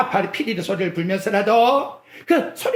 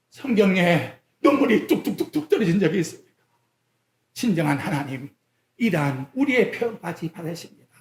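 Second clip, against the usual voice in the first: ordinary delivery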